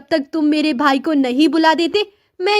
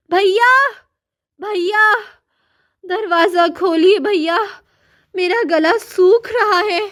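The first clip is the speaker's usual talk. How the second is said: high pleading calls to her brother through the door; calling out desperately; 4 semitones higher than usual. Acted. Starving sister locked in the room calls her brother, high pleading calls. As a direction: cry out for help, weak with thirst.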